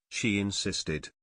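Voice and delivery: ordinary delivery, nothing marked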